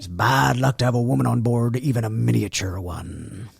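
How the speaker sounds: a lightly put-on West Country ship's mate; West Country pirate. Quoting the Pirates of the Caribbean mate, in a mild West Country accent. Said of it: "that seems kinda West Country".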